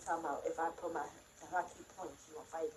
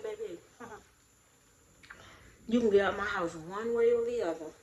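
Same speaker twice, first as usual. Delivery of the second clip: loud and laughing, mock sweet; half-laugh as you tell her to get out, loudly; laughing threat; 11 dB louder than usual. To her partner, ordering her out with a loud laugh.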